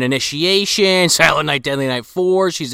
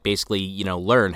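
ordinary talk

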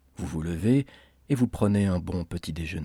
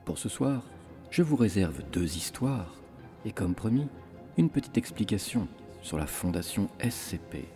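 horror host; 5 dB quieter than usual. Acted. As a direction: welcome them into the crypt, ominously. Horror podcast host intro.

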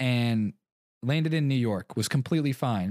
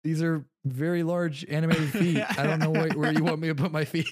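exasperated; 5 semitones higher than usual. Retold or imitated. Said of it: "I'm like".

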